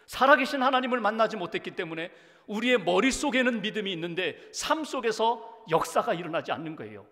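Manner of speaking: 소리 높여 설교하듯; full-force preaching. Acted. Preaching builds up. (회중에게 힘주어 외치는 설교)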